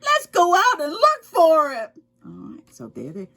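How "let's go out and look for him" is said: hopeful little animal. A narrator's reading. Squirrel and cat resolve to search.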